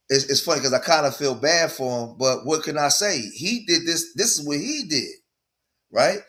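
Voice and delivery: normal talking voice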